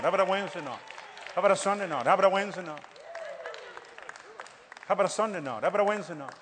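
rattling off the days like an auctioneer; quick repetitive auction chant; auctioneer patter. Pretend. Preacher rattling off days like an auctioneer to the congregation. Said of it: "I sound like an auctioneer".

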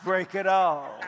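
loud laughing warning, slowed down. Retold or imitated. Preacher warning the angry husband, laughing loudly. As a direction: warn him off through laughter. His own reply, laughing.

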